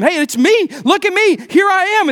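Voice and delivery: a man begging his friends to recognise him; healed man insisting to his doubting friends, excited; pleading to be believed. Retold or imitated. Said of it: "He really tries to convince them".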